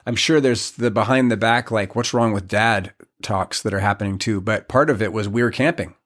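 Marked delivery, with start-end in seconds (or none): none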